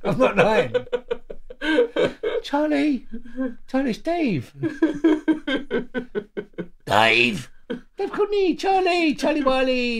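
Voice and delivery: put-on high, loud South London gangster accent. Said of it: "in my best gangster South London voice".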